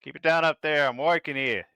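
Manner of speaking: high, loud New York shout; New York accent. Acted. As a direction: yell it like a cranky New Yorker. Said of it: "he's gonna have a New York accent".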